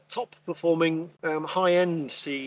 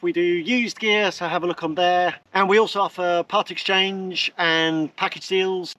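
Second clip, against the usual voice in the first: upbeat ad read; 3 semitones higher, 3 dB louder than usual. Acted. Presenter plugging the flybubble website to viewers, upbeat ad read. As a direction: sell the shop to the viewers with a lift. Closing plug for the shop.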